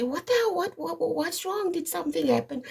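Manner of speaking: high, startled mock alarm; alarmed mom. Mother startled by her daughter's calls, mock alarm. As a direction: jump up in a mock panic. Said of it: "I would say".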